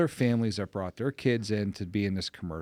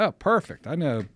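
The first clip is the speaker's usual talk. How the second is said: higher, lightly hammed-up enthusiasm; eager reply; 5 semitones higher, 6 dB louder than usual. Father retelling his answer to the commercial offer, eager. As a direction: pitch it up, playfully sold.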